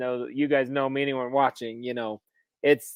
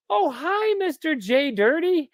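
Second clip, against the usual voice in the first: much higher, drawn-out sweet greeting; chirpy postal clerk; 12 semitones higher, slower than usual. Retold or imitated. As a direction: imitate the cheery post office lady. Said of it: "She goes".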